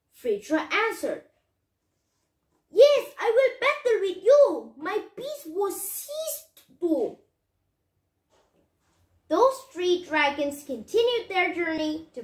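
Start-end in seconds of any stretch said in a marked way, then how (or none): 2.7-7.2 dragon agreeing. Acted. Dragon answers, then tells loss.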